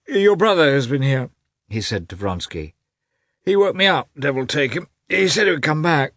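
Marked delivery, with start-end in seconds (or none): 0.1-1.3 high, loud blurted news; roused sleeper's outburst. A narrator's reading. Startled awake, blurts news. 3.5-6.1 high-pitched grumbling complaint; woken grumble. A narrator's reading. Grumbling after being woken.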